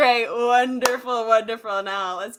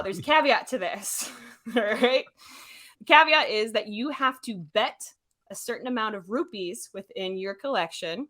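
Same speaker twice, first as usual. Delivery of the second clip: usual speaking voice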